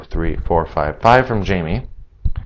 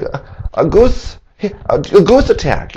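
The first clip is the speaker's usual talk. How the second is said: lightly voiced, higher and stammering; dazed victim; 9 semitones higher than usual. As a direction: mumble it out, embarrassed and dazed. Voicing dazed victim answering.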